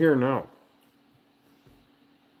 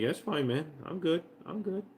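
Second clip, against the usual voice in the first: higher, softer laid-back dog voice; voicing the dog; 8 semitones higher, 7 dB quieter than usual. Voicing what the dog thinks while holding its paw, higher and soft. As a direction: speak for the dog, relaxed and high. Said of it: "You're like".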